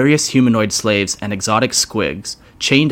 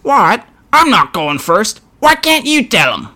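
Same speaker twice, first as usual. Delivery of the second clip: very high, louder goblin falsetto; wavering falsetto grot; 11 semitones higher, 5 dB louder than usual. Acted. Smaller grot refusing to go first, wavering falsetto. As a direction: squeak it out, panicky. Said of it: "with his falsetto voice while he was wavering".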